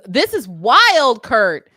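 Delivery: loud, stretched-out amazement; amazed. Real, not acted. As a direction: marvel out loud. Amazed by the old clip.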